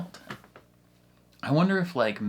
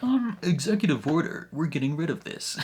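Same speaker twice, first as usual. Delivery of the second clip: mock Trump; 5 semitones higher, 3 dB louder than usual. Imitating Trump dismissively.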